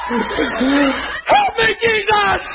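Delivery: high-pitched, wailing hammed-up alarm; mock panic. Preacher imitating believers rallying prayer chains, mock panic. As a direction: cry out like a frantic believer. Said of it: "Do you see what we do?".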